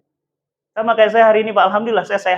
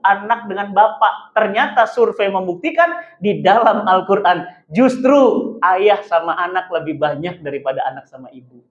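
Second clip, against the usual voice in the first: ordinary talk, nothing marked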